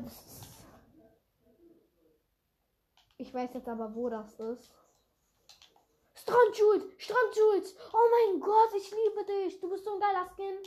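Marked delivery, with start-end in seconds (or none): 6.1-10.6 loud, high thrilled shrieking; excited squeal. Real, not acted. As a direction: gush over it, overjoyed. Entdeckt Lieblingsskin, begeistert. (begeistertes Kreischen)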